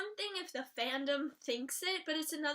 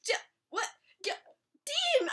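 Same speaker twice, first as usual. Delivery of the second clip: stuttered, rising to a high shout of Dean; stammering panic; 11 semitones higher, 4 dB louder than usual. Retold or imitated. Fan watching Dean stab himself, panicked shout. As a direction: fumble then scream the name. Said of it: "And I was like".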